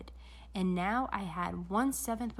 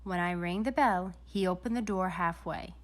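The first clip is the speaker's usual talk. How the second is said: ordinary delivery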